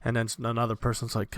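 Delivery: normal talking voice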